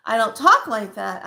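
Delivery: emphatic, lifted delivery, punching TALK. Shown as a demonstration. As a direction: lift the voice and assert it. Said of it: "I talk with a higher voice".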